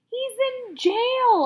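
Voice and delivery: slow, high, loud shout. A narrator's reading. Officer shouting the plain answer at the boy, slow loud shout. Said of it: "Officer Spence shouted".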